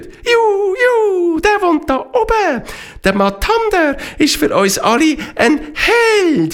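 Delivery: very high squeaky bird voice; chirpy jackdaw. Acted. Jackdaws telling the horses about Matander, very high and chirpy.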